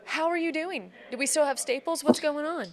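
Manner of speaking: bursting to know how he's doing; excited questions. Real, not acted. Host eagerly asking the kidney recipient how he's doing, excited.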